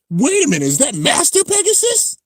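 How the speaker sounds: very high, real astonishment; astonished. Real, not acted. Streamer recognizing Master Pegasus, astonished. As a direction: shoot up high in disbelief on the question. Spotting a possible rare bay.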